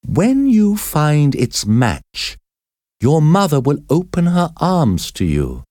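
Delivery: high, slow, solemn reading; reading a note aloud. A narrator's reading. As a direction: read the note slowly and reverently. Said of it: "Esmeralda read it aloud".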